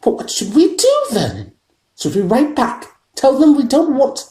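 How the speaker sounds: worried wife pleads; anxious wife. A narrator's reading. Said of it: "Aunt Petunia".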